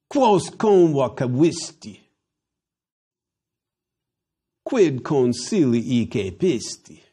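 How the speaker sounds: slow, measured Latin questions; oratorical reading. Acted. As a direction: put each question to the accused like an orator. Reads Cicero's accusing questions.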